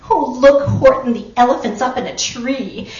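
delighted jeer at a friend; gleeful shouting. A narrator's reading. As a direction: shout it out as a taunt. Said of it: "they shouted with glee".

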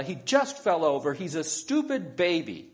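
put-on heated self-defence from an angry child; yelled protest. A narrator's reading. Isabella blaming her little brother, yelling in protest. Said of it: "yelled Isabella".

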